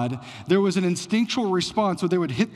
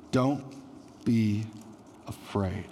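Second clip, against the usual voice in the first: slow, softer, falling into a hush; gentle reassurance; 6 semitones lower, 5 dB quieter, slower than usual. A narrator's reading. Angel calms the terrified shepherds, soft and tender. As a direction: soothe them, don't be afraid.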